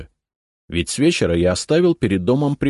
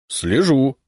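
higher, loud defiant reply; 7 semitones higher, 4 dB louder than usual. A narrator's reading. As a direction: throw it back as a challenge. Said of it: "с вызовом ответил Черницын".

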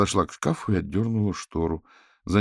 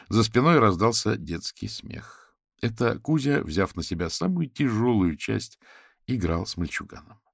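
ordinary delivery

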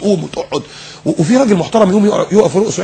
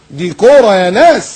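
ordinary delivery

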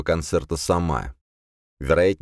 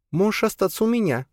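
much higher woman voice; female character voice. A narrator's reading. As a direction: voice Alisa higher. Alisa voiced.

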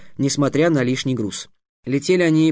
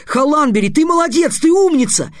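much higher, louder joyful praise; excited praise; 12 semitones higher, 5 dB louder than usual. Acted. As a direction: hug him and cheer him up. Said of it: "вскочил и крепко обнял".

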